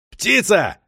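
loud, high summoning shout; bellowed call through the wall; yelling. A narrator's reading. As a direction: holler for your colleague next door. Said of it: "заорал Токарев-старший".

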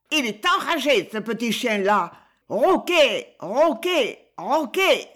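high, loud angry parrot cry; furious parrot squawk. Acted. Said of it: "Passant un bec furieux".

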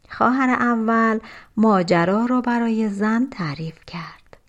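plain reading voice

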